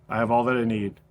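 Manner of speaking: quiet words breathed out after a sigh; soft exhale prayer. Shown as a demonstration. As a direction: release the line on the out-breath. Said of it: "a single breath".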